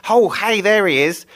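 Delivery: suddenly spot your pal; crying out suddenly. Acted. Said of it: "he cried suddenly".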